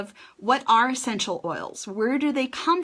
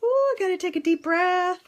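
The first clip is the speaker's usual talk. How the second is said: higher, bubbly live hello with nervous energy; excited; 6 semitones higher than usual. Real, not acted. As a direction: burst of excitement going live. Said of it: "I'm so excited".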